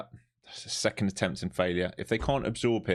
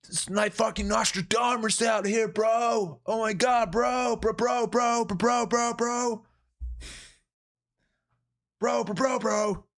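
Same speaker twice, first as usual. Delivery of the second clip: Chris D'Elia bit; 14 semitones higher than usual. Acted. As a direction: hype up like a giddy bro comedian. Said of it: "I've been watching too much Chris D'Elia".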